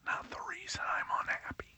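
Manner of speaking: breathy secret whisper. Acted. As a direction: let it slip out as a hushed aside. Confiding punchline aside.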